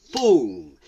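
plain reading voice

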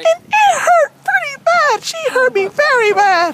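character storytelling voice; aggrieved Wendy. Acted. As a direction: recount it as a wronged cartoon girl. Wendy complains of being hurt.

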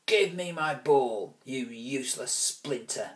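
slow, loud growl at the start; growled threat. Acted. Bully Alvis growling at Ruskin over his ball. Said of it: "Alvis growled".

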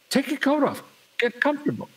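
high put-on voice; high-voiced mock hospitality; obliging valet impression. Valet gives orders in story.

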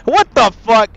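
loud angry blurt; angry outburst. Real, not acted. Gamer snapping at the game, loud and angry. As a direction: bark it at the screen. Gamer furious at stuck puzzle.

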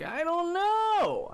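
very high, slow sing-song; odd voice. Real, not acted. Streamer wonders aloud why he talks so strangely, high drawn out. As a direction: stretch it out high and silly. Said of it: "why am I talking like that?".

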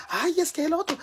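very high mock outburst; self-mimicking exclamation. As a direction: imitate your own squeal of exasperation. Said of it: "y yo". (exclamación imitada, muy aguda)

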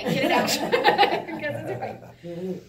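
laughing. Real, not acted. Joking about King James.

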